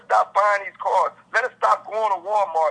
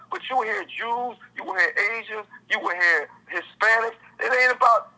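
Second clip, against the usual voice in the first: ordinary talk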